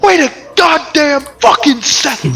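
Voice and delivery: high, loud indignant yell; outraged shout. Acted. Harlem hears the bandit's voice and yells, outraged. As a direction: explode in disbelief. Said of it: "he's like".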